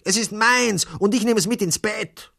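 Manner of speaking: high, loud refusal; childish outburst of anger; angry boy's shout. A narrator's reading. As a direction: yell it like a furious little boy. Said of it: "schrie ein stupsnäsiger Junge zornig".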